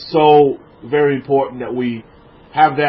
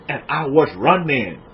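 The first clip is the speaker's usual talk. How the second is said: Forrest Gump; 3 semitones lower, 5 dB quieter than usual. Acted. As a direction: stretch it out in a low country accent. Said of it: "I love that accent".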